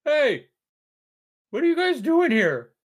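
Jerry voice. Acted. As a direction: pitch Jerry way up, loud and nasal-silly. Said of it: "And Jerry goes".

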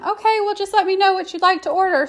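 high, bubbly and quick; perky drive-thru worker; vivacious cashier. Retold or imitated. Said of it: "So vivacious and exuberant".

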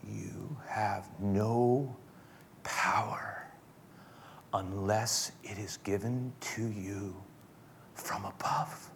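very slow, low and near-whispered; hushed reply. Acted. Jesus answering Pilate's threat, hushed and slow. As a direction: answer softly, leaving space between phrases.

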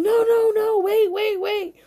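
high-pitched, repeated protest; panicked pleading. Retold or imitated. He stops her walking in, high and panicked. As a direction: imitate a man frantically stopping her.

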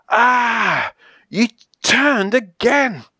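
high, drawn-out protest; theatrical exasperation; dismayed outcry. Acted. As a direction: gasp out your disbelief, stretching the words. Dismayed at another page turn.

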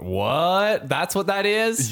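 high, loud startled "what?"; shocked disbelief. Retold or imitated. Telling a friend about the Oliver's chain, shocked and disbelieving. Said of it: "I'm like".

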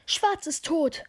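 acted panicky shout, pitched high; panicked cry. Narrator alerts the crew to a death, acted panicked cry. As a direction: cry out the alarm in mock panic. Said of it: "Ich schrie vor Panik".

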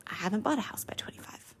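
soft, fast, breathless whisper; whispered aside. Retold or imitated. Whispering her own envious thought to listeners, fast. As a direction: confess it under your breath. Self-pitying inner voice aside.

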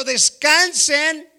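drawn-out loud call of descansen; proclaiming rest. Retold or imitated. God telling the saints to rest, slow and loud. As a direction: stretch descansen long and strong. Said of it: "Y Dios está diciendo".

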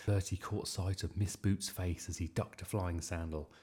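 usual speaking voice

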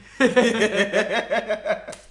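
big high laughing fit; loud laugh. Real, not acted.

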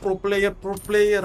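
slow, high mocking repeat. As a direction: scoff at his pro player dream. Mocking the son's dream.

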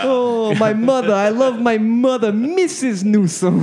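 put-on high, slow Jersey mama's boy; Jersey accent. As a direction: ham up a heavy Jersey accent. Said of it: "heavy Jersey accents".

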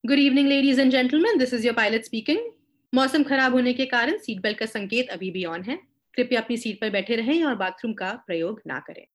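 airline pilot announcement; formal cabin PA voice; pilot cockpit headset. Retold or imitated.

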